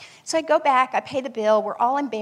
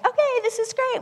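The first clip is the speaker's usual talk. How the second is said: chirpy relief; 13 semitones higher than usual. Retold or imitated. Relief at finding coins for snacks.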